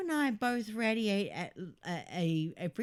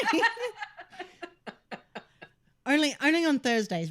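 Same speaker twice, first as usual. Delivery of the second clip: louder, laughing quip; laughing joke; 12 semitones higher, 7 dB louder, faster than usual. Real, not acted. Joking while laughing.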